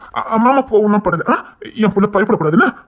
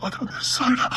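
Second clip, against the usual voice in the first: faint unvoiced whisper; whispered apology; 9 dB quieter, slower than usual. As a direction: whisper it with last breath.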